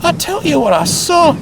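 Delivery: nervous. Acted. Nervous twin insists.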